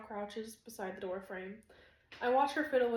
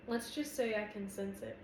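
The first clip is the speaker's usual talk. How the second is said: plain reading voice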